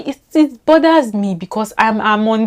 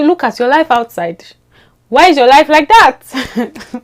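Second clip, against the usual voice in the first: exasperated outcry; 6 semitones higher, 7 dB louder than usual. Real, not acted. Vlogger scolding the friend who changed her accent, exasperated outcry. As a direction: demand it in mock exasperation.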